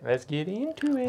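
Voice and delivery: hushed whisper. Shown as a demonstration. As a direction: whisper it conspiratorially. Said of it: "Yeah, let's get into it".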